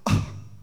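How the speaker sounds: a short startled mock gasp. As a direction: gasp as if shocked by the verse. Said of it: "you go".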